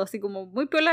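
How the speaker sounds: usual speaking voice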